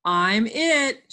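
eager read-aloud shout. Acted. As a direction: call it out to the playmates. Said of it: "shouted Fritz".

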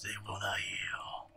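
low angsty whisper; whispered emo lyric. Retold or imitated. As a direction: brood it under your breath. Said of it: "listen to [name]".

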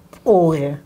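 pore, the wrong stressed reading. Shown as a demonstration. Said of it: "I can't say it that way".

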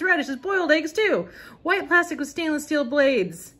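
mild mock pitchman reading the catalog. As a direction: sell it like an old TV ad, a touch over the top. Reads the Veg-O-Matic ad copy.

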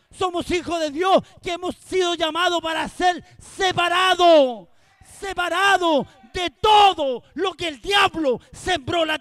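loud, ringing pulpit cry; fiery Spanish sermon shout; full-force preaching. Acted. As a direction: preach it out to the whole congregation.

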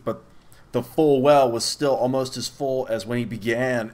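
usual speaking voice

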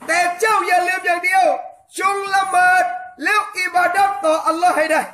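fervent shouted sermon, hammering each question; preaching at full force. Acted. Preacher rousing the island congregation, fervent shouting sermon.

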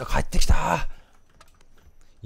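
slow, high, loud surprised call; surprised drawn-out call. Real, not acted. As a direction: stretch the word out in delight. Cat comes running back.

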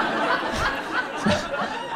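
real high wheeze of laughter; cracking up at the story; high breathy laugh. Real, not acted. Comic cracking up at his own joke, laughing.

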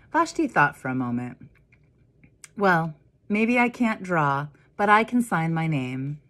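put-on lively read-aloud for children; story narration. A narrator's reading. Minister reading The Dot to the congregation, lively storytelling. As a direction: read it like a picture book to kids. Reads picture book to children.

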